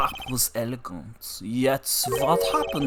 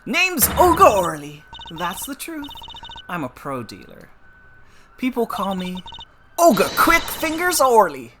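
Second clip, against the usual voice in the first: higher brash card-sharp voice; brassy dealer; 9 semitones higher, 6 dB louder than usual. Acted. Dealer introducing herself in court, brash and higher. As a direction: play a cocky gambler lady. Voicing Olga.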